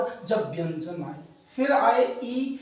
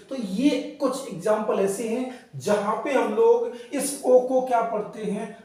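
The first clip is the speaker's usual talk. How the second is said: ordinary delivery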